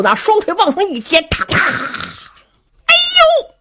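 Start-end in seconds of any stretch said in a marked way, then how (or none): 2.8-3.5 high yelp that cracks mid-cry; a pained cry with the voice cracking; cracked yelp. Acted. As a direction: let the voice break on a pained yelp. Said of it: "喊岔了音儿了".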